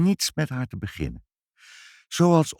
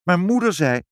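plain reading voice

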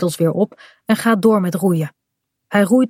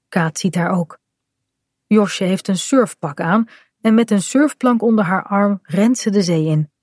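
ordinary delivery

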